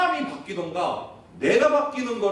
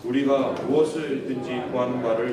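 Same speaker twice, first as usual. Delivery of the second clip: scripture reading; 4 semitones lower than usual. Acted.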